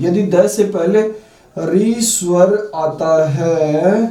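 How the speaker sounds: very slow, word by word; slow dictation of a grammar rule. Shown as a demonstration. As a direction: dictate the rule slowly for writing. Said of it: "वो मैं special note पहले लिखाऊंगा".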